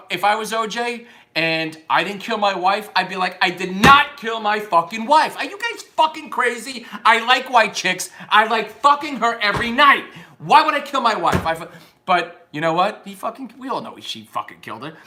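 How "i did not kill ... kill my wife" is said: loud, high indignant outburst; hammed-up outraged denial; convinced, emphatic denial. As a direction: protest innocence with total conviction. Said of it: "when you're fucking convinced of something".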